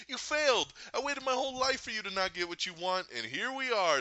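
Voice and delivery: high-pitched imitation of a triumphant woman; gloating taunt. Gamora starts laughing in Thanos's face, mock gloating. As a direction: crow it high and loud at first, mimicking her. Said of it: "Gamora starts laughing, and she's like".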